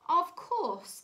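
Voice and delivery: high, loud, ready reply; acted helpful bird voice; obliging secretary bird. A narrator's reading. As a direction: agree brightly, pitched right up. Secretary bird answers the request.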